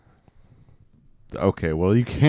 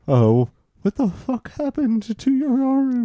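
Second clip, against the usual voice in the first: mock surprised onlooker; 13 semitones higher than usual. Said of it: "somebody was like".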